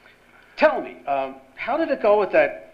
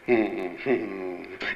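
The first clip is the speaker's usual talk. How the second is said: low chuckle; 9 semitones lower, 7 dB quieter than usual. Acted. Boss amused by Miller.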